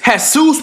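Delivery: "Jesus" said hay-SOOS, with an H sound; Spanish pronunciation. Shown as a demonstration. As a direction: say "Jesus" the Spanish way, stressed on the end. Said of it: "I didn't know his name was Jesus".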